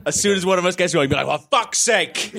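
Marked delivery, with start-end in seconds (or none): 1.3-2.3 drawn-out mock outburst; mock exasperation. Reader imagines giving up on the confusing script, mock exasperated. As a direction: let the exasperation burst out, half laughing.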